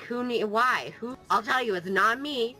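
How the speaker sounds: usual speaking voice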